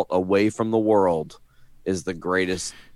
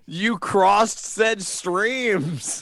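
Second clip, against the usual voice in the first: laughing; 12 semitones higher, much slower than usual. Real, not acted. Laughing at friend's confession.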